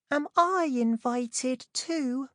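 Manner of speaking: put-on small, high, slow girl's voice; tiny voice. A narrator's reading. Cinderella asks her stepsisters in a tiny high voice. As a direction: ask timidly, barely daring. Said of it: "Cinderella asked in a tiny voice".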